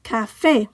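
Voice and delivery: Café with FÉ stressed; stress on accented syllable. Shown as a demonstration. Teacher modelling written accents, Café stressed at the end. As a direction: say Café in Spanish, ca-FÉ. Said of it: "stress the syllable that the accent is on".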